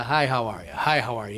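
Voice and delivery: low, perfunctory repeated hello; imitated routine greeting. The Speaker greeting a dozen people in turn, perfunctory. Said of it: "he starts with a".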